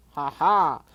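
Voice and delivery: loud mocking dragon laugh. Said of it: "laughed Smaug".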